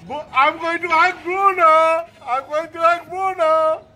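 loud playful dog tease; teasing dog. Real, not acted. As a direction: tease the jealous dog loudly. Teasing jealous dog loudly.

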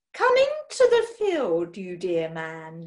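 seductive gangster's moll leer. A narrator's reading. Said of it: "leered Madame Rosalia".

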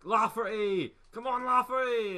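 high loud urging yell; excited shout. Real, not acted. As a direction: cheer him on at full voice. Urging player on.

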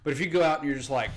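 ordinary talk, nothing marked

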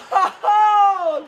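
high cackle of delight; triumphant laughing shout. Real, not acted. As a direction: laugh out the celebration. Laugh after scoring.

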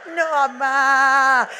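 put-on scared child. Frightened little girl begs her mother not to open the window. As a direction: beg mom, scared and slow.

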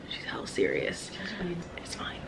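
hushed whisper aside. Real, not acted. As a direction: whisper it behind her back. Aside about friend.

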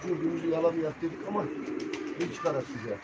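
usual speaking voice

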